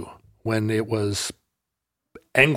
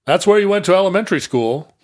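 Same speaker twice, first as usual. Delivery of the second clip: dad voice; 6 semitones higher, faster than usual. Retold or imitated. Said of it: "points out the window and goes".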